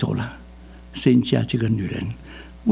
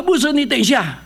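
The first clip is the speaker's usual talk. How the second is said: calling out much higher, lightly voiced; a hint of a retired man stopping the pastor; mock call to wait; old brother calling; 12 semitones higher than usual. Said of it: "他".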